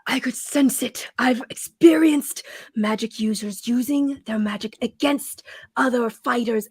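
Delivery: half-whispered accusation; intense claim. Acted. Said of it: "And Sardine going".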